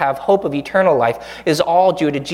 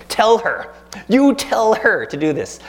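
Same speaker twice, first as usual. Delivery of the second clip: higher, mock-indignant order; bossy demand; 6 semitones higher than usual. Martha to Jesus, a higher, mock-indignant order. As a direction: snap the order up high.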